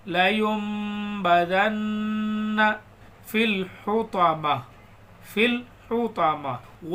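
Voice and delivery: drawn-out, chanted recitation; slow Quran recitation. Shown as a demonstration. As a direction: recite slowly with tajweed. Teacher recites verses slowly.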